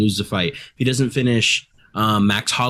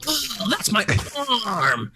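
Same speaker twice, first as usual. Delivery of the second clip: high, strained pained wail; mock agony in a put-on high voice; Gilbert impression; 11 semitones higher, 4 dB quieter, slower than usual. Co-host mocks his friend caught in an armbar, wailing impression. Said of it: "why do you make me sound like that".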